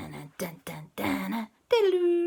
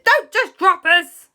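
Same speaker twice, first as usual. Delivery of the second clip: much higher, louder slow protest; protesting shout; 12 semitones higher, 11 dB louder, slower than usual. Acted. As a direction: Auntie Lee refusing, to Superman. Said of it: "Auntie Lee said".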